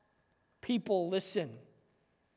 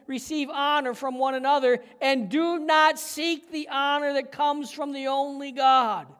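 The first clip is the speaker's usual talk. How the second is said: preached scripture; 4 semitones higher, 6 dB louder than usual. Acted. Pastor preaching Jesus's rebuke to the leaders, full force.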